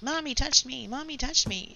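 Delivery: high mock tattling-kid voice; singsong mock tattle; whining teenagers. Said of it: "a room full of teenagers".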